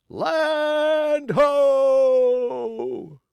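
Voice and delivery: high, loud, long-held call; lookout's shout. A narrator's reading. Lookout calls out to the ship, high loud held shout. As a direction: call it down from the masthead. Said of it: "the lookout shouted".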